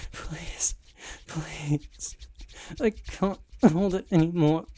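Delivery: frantic desperate plea; desperate begging. Acted. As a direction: beg with everything, near breaking.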